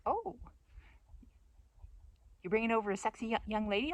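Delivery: high-pitched, teasing "oh" of intrigue; mock surprise. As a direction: play the intrigued friend. Friends' reaction to hot dish.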